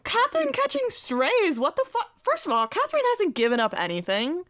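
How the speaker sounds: high, loud outraged disbelief; squealing indignation on her behalf. Real, not acted. Outraged defence of Catherine.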